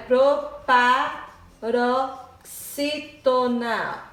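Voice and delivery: "Proparoxítona" very slow, in separated syllables; drawn-out pro-pa-ro-XÍ-to-na. Shown as a demonstration. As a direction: spell out "Proparoxítona" sound by sound as if writing. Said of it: "não vai dar pra escrever".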